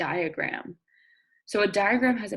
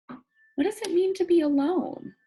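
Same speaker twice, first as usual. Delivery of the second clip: ordinary talk, nothing marked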